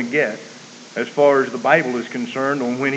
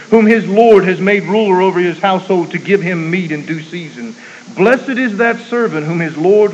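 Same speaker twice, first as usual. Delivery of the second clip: high, loud, surging scripture reading; preaching at full force; 5 semitones higher, 10 dB louder than usual. Acted. Preacher reading the servant parable to the congregation, full force. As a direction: thunder the parable out.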